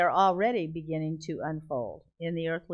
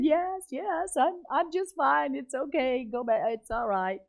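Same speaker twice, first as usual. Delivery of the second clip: high-pitched insistence that all is fine; flustered reassurance; 7 semitones higher than usual. Retold or imitated. Tearful passenger fending off people across the aisle, flustered reassurance. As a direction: wave off the worry in a raised pitch.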